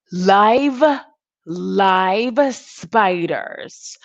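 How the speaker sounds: very slow "live spiders" for effect; slow, dramatic disbelief; slow emphatic. Real, not acted. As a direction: drag it out in disbelief. Shocked at TikTok spiders.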